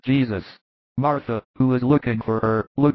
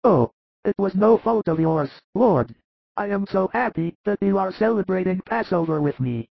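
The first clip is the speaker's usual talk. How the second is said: much higher, weak sick man, tender and grateful; frail Lazarus; 7 semitones higher than usual. Acted. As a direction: feeble high voice of a sick man. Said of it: "kisses it and whispers".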